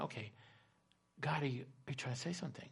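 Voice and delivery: retold thought, spoken softly to God; quiet musing prayer. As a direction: murmur it upward as a private question. Voices widow's inward question to God.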